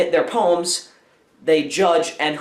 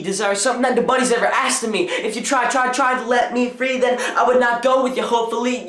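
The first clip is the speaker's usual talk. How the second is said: higher, fast, rhythmic slam-poetry delivery; spoken word poem; 6 semitones higher than usual. Acted. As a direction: perform it like a confident slam poet. Recites his poem.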